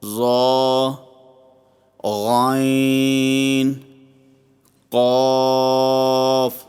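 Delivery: thick, rounded vowels held long and level; slow, sustained emphatic Arabic letters; full-mouthed heavy letters. Shown as a demonstration. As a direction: recite each letter heavy and drawn out. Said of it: "یعنی موٹے پڑھیں".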